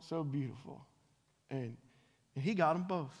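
ordinary talk

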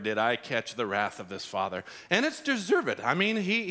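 ordinary delivery

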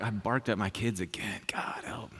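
very soft, half-whispered admission; sheepish confession. Acted. Parent confessing to God about the kids, sheepish. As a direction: confess it under your breath.

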